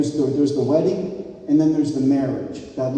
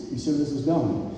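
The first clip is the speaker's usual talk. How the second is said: ordinary delivery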